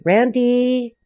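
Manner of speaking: RANDY with a stretched final vowel; drawn-out name. Shown as a demonstration. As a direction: linger on the end of "Randy". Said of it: "I have to say it like that".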